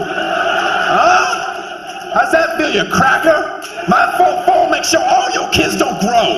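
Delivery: hammed-up rapper threatening the crowd; mock gangsta threat.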